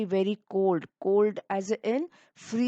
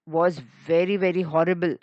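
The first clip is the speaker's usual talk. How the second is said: ordinary delivery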